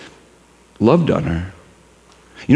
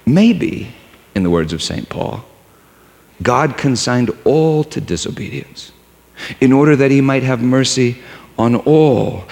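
normal talking voice

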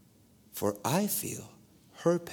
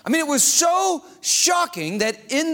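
excited telling; 4 semitones higher than usual. Real, not acted. Preacher telling his own healing to the church. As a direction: relive the shock.

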